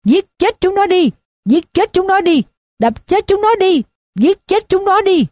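put-on high, frenzied drunken yell; bay for blood like a drunk in a mob. A narrator's reading. Drunk on the chair urging the mob to kill, high yell. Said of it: "rồi nó gào lên".

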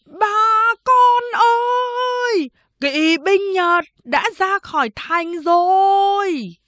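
high, drawn-out call carrying from a watchtower; loud warning cry. Acted. Man atop the tower warning villagers of Japanese cavalry, crying out loudly. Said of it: "gào lớn".

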